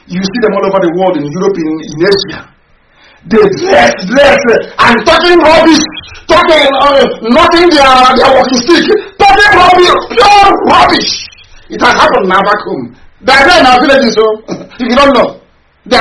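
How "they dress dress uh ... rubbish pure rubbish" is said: higher pitched angry shouts on talking rubbish; furious shout. Real, not acted. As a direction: blast them with scorn, much higher. Furious rant about diaspora.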